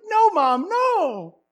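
put-on high pleading kid voice; whiny kid protest. Rusty denying it to his mom, whiny. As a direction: whine no at mom. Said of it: "He's like".

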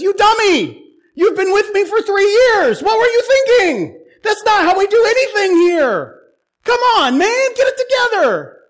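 high, loud mock yelling; exasperated scolding. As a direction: yell like a master fed up with a pupil. Jesus scolding Peter, freely retold.

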